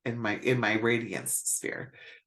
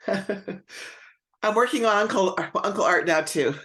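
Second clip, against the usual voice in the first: laughing; 7 semitones higher, 4 dB louder, slower than usual. Real, not acted. Laughing about Karen's uncle.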